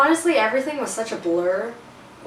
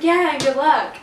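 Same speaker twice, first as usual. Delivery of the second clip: high, loud forced brightness; fake cheerful; 6 semitones higher, 6 dB louder than usual. Girl wishing the boy luck while hiding her rejection, fake cheerful. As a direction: smile through it. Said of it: "put on the smile".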